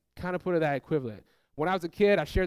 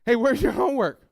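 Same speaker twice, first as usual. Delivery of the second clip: put-on raised voice checking up on a child; parent calling out; 5 semitones higher, 5 dB louder than usual. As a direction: call out higher and louder, demanding.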